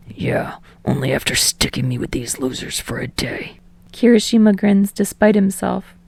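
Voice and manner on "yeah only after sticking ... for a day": low, voiceless grumbling scoff; a much lower sulky whisper. A narrator's reading. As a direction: sneer it under your breath at the others. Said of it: "He scoffs".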